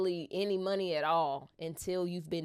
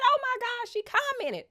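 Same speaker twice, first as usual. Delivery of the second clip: high, breathless disbelief; high-pitched fangirl squeal; excited. Retold or imitated. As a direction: gush like a thrilled fan. Excited retelling fan reaction.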